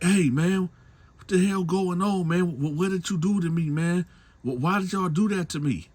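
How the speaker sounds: high, loud, frantic pleading questions; panicked demand. Retold or imitated. Man calls after the big dude, high and frantic. Confronting his captor, retold.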